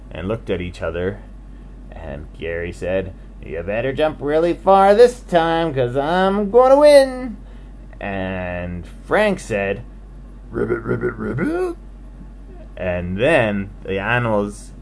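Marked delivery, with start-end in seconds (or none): 3.4-7.3 taunting. Acted. Grasshopper taunts frog. 10.5-11.7 frog croak. Acted. Frank answers Gary with swooping frog croaks.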